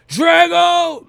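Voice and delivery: high, loud shout in a different voice; Rocky yelling Drago. Retold or imitated. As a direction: roar the rival's name like Rocky on the mountaintop. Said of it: "I feel like Rocky in the mountains".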